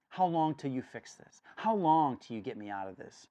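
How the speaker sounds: loud, urgent questions to God; pleading prayer. Acted. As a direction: cry out why.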